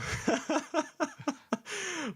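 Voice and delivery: high laugh falling away; giggling fit; high laughter. Real, not acted. Laughing at his own burp.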